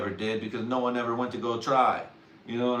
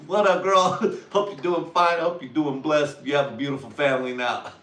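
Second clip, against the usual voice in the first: higher, louder smooth-talker voice with laughs; mock smooth holler; 5 semitones higher, 6 dB louder than usual. Hollering at a girl, a bit louder and higher. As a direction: sweet-talk her with a grin. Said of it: "I was like".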